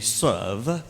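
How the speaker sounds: drawn-out British "Serve"; British accent. Retold or imitated. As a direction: say "Serve" like a British rector, lifting the end. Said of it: "Oh, British accent". ("Serve" sa puntong Briton)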